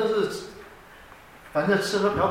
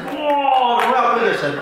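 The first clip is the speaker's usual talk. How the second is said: high, loud awed exclamation; mock gasp of awe; 4 semitones higher, 4 dB louder, slower than usual. Mimicking the congregation's awe at a testimony, loud awed gasp.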